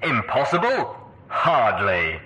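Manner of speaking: Red Eye. A narrator's reading. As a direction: toss the word back, then proclaim the rebuttal. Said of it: "Red Eye's voice proclaimed from the dozen speakers".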